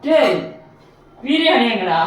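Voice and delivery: loud, high angry yell for the biryani; angry shout. Acted. Man yelling at an empty box, furious shout. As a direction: bellow it out, furious.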